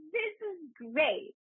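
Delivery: put-on be the happy little kid; younger self, delighted. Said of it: "She was like".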